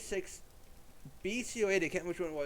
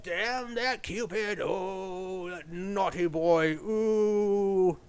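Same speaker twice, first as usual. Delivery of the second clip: slow, drawn-out swoon, getting louder; lovestruck mock curse; 2 semitones higher, 7 dB louder, much slower than usual. Man hit by Cupid's arrow, slow and swooning, louder at the end. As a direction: groan it like a smitten man. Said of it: "and he's like".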